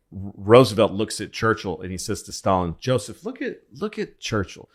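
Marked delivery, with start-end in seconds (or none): 2.8-4.7 high-pitched, conspiratorial ridicule; acted gleeful mockery; mocking. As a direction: point him out for a laugh at his expense. Roosevelt mocking Churchill to Stalin.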